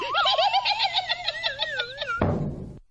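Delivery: high witch cackle; long gloating witch laugh; evil cackle. Acted. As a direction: cackle triumphantly like a wicked witch. Witch laughs after taunt.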